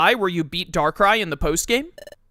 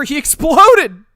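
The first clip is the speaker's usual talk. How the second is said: excited exclaim; 9 semitones higher, 10 dB louder, much slower than usual. Real, not acted. Reacts to Darkrai's fate.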